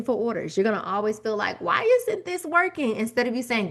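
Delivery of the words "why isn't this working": high, exasperated "Why isn't this working?"; frustrated question. Retold or imitated. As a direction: whine it out, pitched up, at a loss. Frustrated seller's inner voice.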